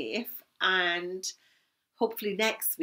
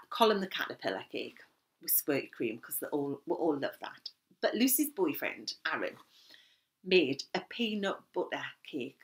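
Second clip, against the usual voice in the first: normal talking voice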